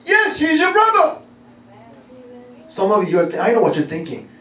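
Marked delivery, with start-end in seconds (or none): 0.1-1.2 high, ringing insistence; emphatic affirmation. Real, not acted. Preacher answering the doubting believer, high emphatic yes.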